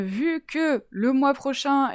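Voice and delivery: usual speaking voice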